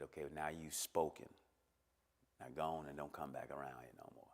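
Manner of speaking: low, soft, cold send-off; subdued, low-voiced rejection; dismissive father. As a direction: send him away quietly, without warmth. Father dismisses him, retold low.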